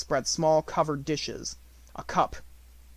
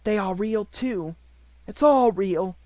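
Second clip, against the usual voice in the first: excited cry; 6 semitones higher, 5 dB louder, slower than usual. Acted. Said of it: "she cried".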